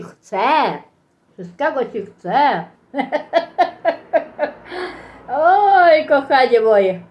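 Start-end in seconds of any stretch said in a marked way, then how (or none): 2.9-5.1 laughing. Real, not acted. Laughs at her own remark. 5.3-7.0 laughing. Real, not acted. Laughing exclamation.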